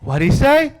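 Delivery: high, loud, baffled wife voice; mock confused wife. Husband mimics his confused wife during a show, high and puzzled. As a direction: squeak it out like a lost viewer.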